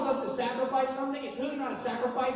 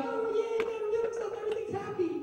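mock cheer; 10 semitones higher than usual. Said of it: "It's not gonna be like".

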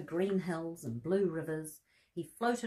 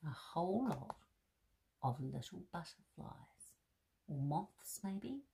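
softer, drawn-in voice; hushed wonder; 3 semitones lower, 8 dB quieter than usual. Real, not acted. Teller leans in to the child, hushed and curious. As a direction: lower the voice to a hush of curiosity.

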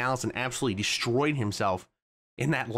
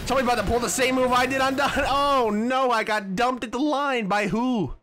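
excited disbelief; 10 semitones higher, 3 dB louder than usual. Real, not acted. Gets wrecked at the finish.

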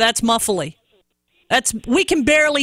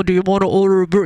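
quick, crammed drive-thru mumble; imitating a muffled McDonald's order taker; mock muffled drive-thru voice; 6 semitones lower, much faster than usual. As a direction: lips right on the mic like a fast-food speaker. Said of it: "they put their mouth so close to the thing".

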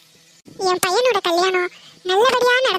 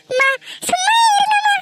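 crying plea; 10 semitones higher than usual. Acted. Daughter cries out begging her mother to stop, sobbing. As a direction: beg her to stop through tears.

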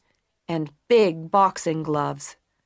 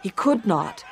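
usual speaking voice

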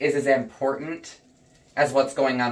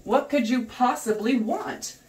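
higher and slow, sweetly sarcastic; mock polite sarcasm; 8 semitones higher, much slower than usual. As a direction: act overly polite to a cop. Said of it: "And I'm like".

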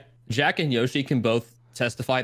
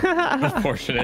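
laughing, slow, higher "unfortunate"; 12 semitones higher, slower than usual. Acted. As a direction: say it through a laugh. Repeat while laughing.

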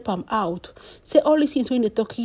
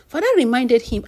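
usual speaking voice

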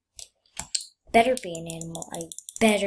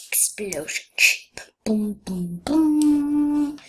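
mock explosion; 5 semitones higher, 5 dB louder, much slower than usual.

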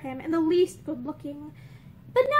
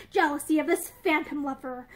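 plain reading voice

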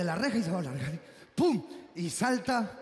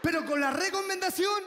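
mock court verdict; 8 semitones higher than usual. Mock official verdict read.

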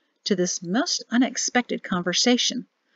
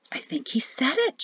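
softer, higher, to herself; woman musing half to herself; 4 semitones higher, 7 dB quieter than usual. A narrator's reading. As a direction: wonder aloud quietly. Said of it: "she mused".

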